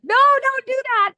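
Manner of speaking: loud, high, slow warning yell; yelling at a movie. Retold or imitated. Yelling at a scary movie character, loud and high. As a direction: shout at the character on screen. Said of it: "I also yell when I watch scary movies".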